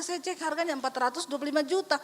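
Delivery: ordinary talk, nothing marked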